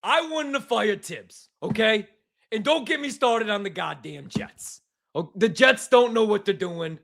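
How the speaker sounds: put-on thick New York sports caller accent, ranting; mock New York caller accent, bitter. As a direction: rant like a Jets fan calling the radio. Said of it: "Good accent".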